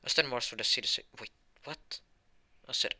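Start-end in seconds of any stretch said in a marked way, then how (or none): 1.2-2.0 whispered, much softer wait what; stunned whisper. Acted. A shocked bystander whispers in confusion. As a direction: breathe it in disbelief.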